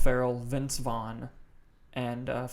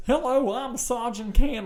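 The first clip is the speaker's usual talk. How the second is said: high, slow Southern drawl; Southern accent; 10 semitones higher, slower than usual. Retold or imitated. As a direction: put on a drawn-out, high-pitched Southern accent. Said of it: "like, this southern accent".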